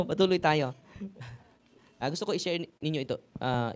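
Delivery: ordinary delivery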